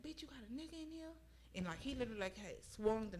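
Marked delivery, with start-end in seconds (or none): 0.0-1.1 much higher, hushed imitation of the attacker; soft, high-pitched quote of his accusing question; retold hushed accusation. Retelling the attacker spotting another man, hushed and high.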